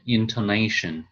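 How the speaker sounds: intoNAtion, weight on the syllable before last; stress on second-to-last syllable. Shown as a demonstration. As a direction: lift the NA in intonation. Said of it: "we would rise ne".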